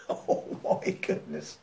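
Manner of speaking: laughing. Real, not acted. Preacher laughing at his own story, softly. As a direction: laugh it out under the breath.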